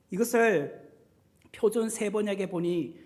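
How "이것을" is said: ordinary delivery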